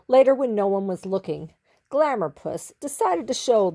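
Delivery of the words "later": ordinary delivery, nothing marked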